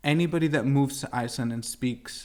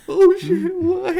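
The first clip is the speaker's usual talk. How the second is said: shocked yelp; 10 dB louder, slower than usual. Retold or imitated. Retelling shock at bad test news.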